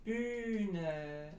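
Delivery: Bühne with a long, tense, rounded ü; long German ü. Shown as a demonstration. Teacher giving example words, Bühne with long rounded ü. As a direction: stretch the ü in Bühne slowly. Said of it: "Ü has to be a long Ü".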